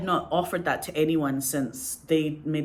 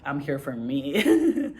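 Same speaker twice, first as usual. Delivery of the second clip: drawn-out, louder-ending send-up; mock self-centred; 4 dB louder, much slower than usual. Actor sending up self-absorbed performers on stage, mock self-centred. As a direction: stretch it out, tipping into a laugh.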